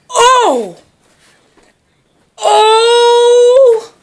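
high wailing oh; mock wail. Real, not acted. Owner wailing over the torn Santa toy. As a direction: cry out in mock despair.

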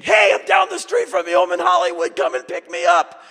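high, loud excited call; imagined Kim shouting. Pretend. Imagined Kim calling out that he's nearby, loud and excited. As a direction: call out cheerfully from down the street.

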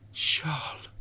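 high, breathed whisper; whispered echo of a dying woman; haunting whisper. A narrator's reading. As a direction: whisper it as a remembered last word. Dying woman's last word recalled.

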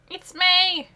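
loud, high joyful call; excited announcement. Acted. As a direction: announce her like big news.

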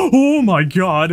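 startled gasp. Real, not acted. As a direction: caught off guard by a near fall. Gasp at a near fall.

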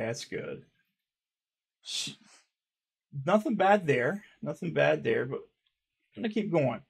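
ordinary delivery

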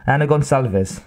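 usual speaking voice

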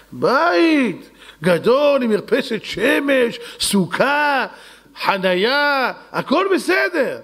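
high, slow, thrilled listing; delighted husband. Acted. Husband showing his wife the new house, high and slow with joy. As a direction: savours each feature of the new house.

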